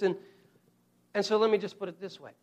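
ordinary talk, nothing marked

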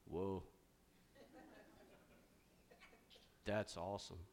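lightly imitated Keanu Reeves; a low, soft laid-back surfer-dude Whoa; Keanu Reeves drawl. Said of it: "Keanu Reeves may talk like that".

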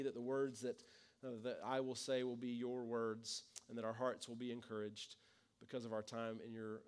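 soft, hushed prayer voice. Real, not acted. Pastor praying over the congregation, soft and reverent. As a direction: pray quietly before the sermon.

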